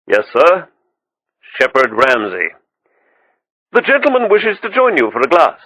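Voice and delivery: polite high waiter voice; a deferential waiter offering a gift. A narrator's reading. Waiter reports the name.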